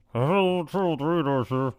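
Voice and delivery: mouth-made distorted radio voice; garbled intercom. Retold or imitated. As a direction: imitate talking through a bad speaker. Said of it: "You don't need that".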